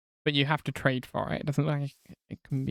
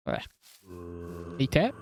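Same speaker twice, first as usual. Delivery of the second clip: ordinary delivery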